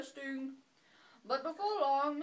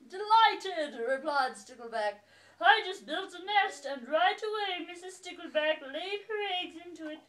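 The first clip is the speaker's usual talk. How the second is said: cheerful fish voice; 3 semitones higher, 8 dB louder than usual. Acted. Stickleback voiced loud.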